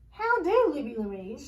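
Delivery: lilting high greeting that drops; old neighbor's sing-song hello. A narrator's reading. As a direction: sing it out to the girl. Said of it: "in her sing-song voice".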